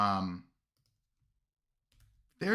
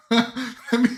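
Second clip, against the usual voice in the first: loud high laugh breaking through; 11 semitones higher, 10 dB louder than usual. Real, not acted. Streamer laughs at his failed gargoyle attempt, loud laugh. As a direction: crack up at yourself.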